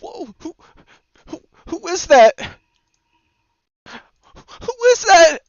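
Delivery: squeaky falsetto, building to a loud, drawn-out question; mock-startled falsetto; high put-on voice. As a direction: go way up high, hesitant then loud. Playful put-on voice after being scared.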